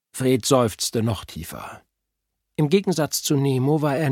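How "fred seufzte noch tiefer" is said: ordinary delivery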